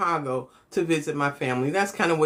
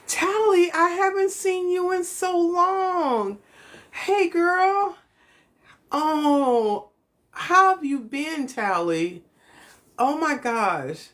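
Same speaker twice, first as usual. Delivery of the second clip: imitating dad's much higher, slowed-down friendly greeting voice; dad's code-switch voice; 10 semitones higher, slower than usual. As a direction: play the dad switching into a high, sing-song, overly friendly voice to greet an old friend. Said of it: "he started code switching".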